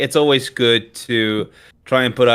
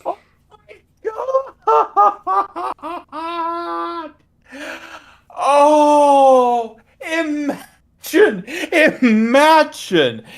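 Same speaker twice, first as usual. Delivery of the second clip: laughing shriek; much slower than usual. Real, not acted. Shocked laughter at joke.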